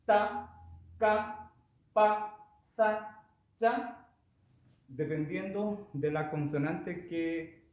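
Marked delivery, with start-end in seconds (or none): 0.0-4.1 ta ka pa sa cha, each hit high, loud and tense; tense consonant demo. Shown as a demonstration. Teacher showing students how tense Korean consonants sound, high and forceful. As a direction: snap out ta ka pa sa cha as hardened doubled consonants. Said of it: "Por ejemplo, que suene".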